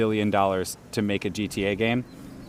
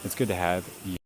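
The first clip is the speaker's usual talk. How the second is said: bored, dutiful "It's good to have you."; unenthusiastic; 3 semitones lower, 6 dB quieter than usual. Real, not acted. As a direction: say it with no enthusiasm at all. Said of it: "Least enthusiastic".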